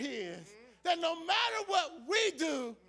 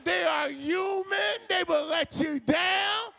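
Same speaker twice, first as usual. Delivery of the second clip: preaching at full force; 4 semitones higher than usual. Acted. Pastor urging the church not to depend on people, preaching at full force. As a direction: drive it home to the congregation, full voice.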